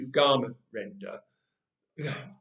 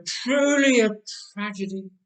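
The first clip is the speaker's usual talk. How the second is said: sighing; 5 semitones higher than usual. A narrator's reading. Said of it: "sighed Sarah".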